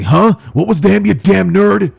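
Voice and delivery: high, loud indignant outburst; acted anger. Bakugo turning round on Deku, indignant. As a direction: round on him angrily.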